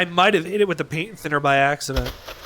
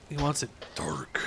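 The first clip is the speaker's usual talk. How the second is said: hushed whisper to himself; 2 semitones lower, 9 dB quieter than usual. Real, not acted. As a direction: whisper it under your breath. Repeats the line in a whisper.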